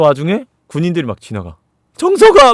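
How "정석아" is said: urgent high shout of a name; acted alarmed yell; calling out. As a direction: call out loud and high. Calling out as soldiers pass.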